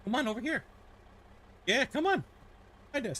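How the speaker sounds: high coaxing call to the cat; pet talk. Real, not acted.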